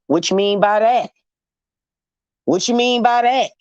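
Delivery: mildly put-on indignant questioning; acted offended challenge. Imitating men who get offended at her sarcasm, mildly indignant. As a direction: play someone quick to take offence, repeating the challenge. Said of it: "they get offended".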